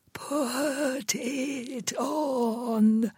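high, faint, very slow whisper; old woman's faint whisper to her visitor; frail whisper. Acted. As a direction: barely breathe it out. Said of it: "as she whispered a second time".

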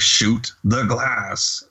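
very slow, deliberate command; imitated order, stretched out for someone not understanding; imitating Hans. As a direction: spell out the order one word at a time. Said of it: "he's just like".

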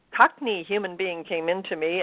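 ordinary delivery

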